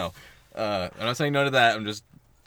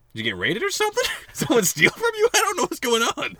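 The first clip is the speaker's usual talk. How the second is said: surprised; 5 dB louder, faster than usual. Retold or imitated. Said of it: "And I was just like".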